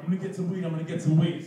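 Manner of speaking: half-hearted angry yell; retold yelling.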